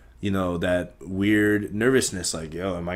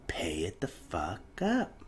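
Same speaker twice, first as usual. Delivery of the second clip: whispered aside; 8 dB quieter, slower than usual. Real, not acted. Muttering about paying off debt.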